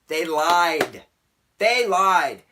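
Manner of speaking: loud, drawn-out indignation; furious accusation. Real, not acted. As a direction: hit it hard, then harder. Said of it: "I'm so furious".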